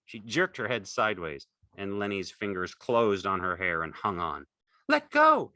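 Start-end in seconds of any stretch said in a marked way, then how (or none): 4.9-5.5 very high, loud demand; alarmed cry. A narrator's reading. Curly's wife struggles free of Lenny, loud cry. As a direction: cry out sharply at the top of the voice. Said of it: "she cried".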